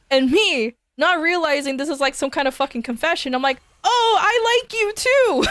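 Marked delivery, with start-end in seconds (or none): 3.8-5.4 slow, much higher, bright and clueless; oblivious cheerful reply. Retold or imitated. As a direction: sing it out brightly, missing the point.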